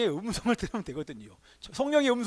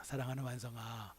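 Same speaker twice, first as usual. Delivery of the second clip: soft, lower, tender calling of the name; 7 semitones lower, 12 dB quieter than usual. Retold or imitated. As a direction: call him gently as a loving father. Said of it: "꼭 그렇게 붙여주십니다". (다정하고 부드럽게 부르는 목소리)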